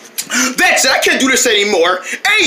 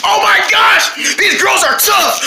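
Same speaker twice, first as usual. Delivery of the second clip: excited cry; 6 semitones higher than usual. Acted. Kids playing, startled exclaims.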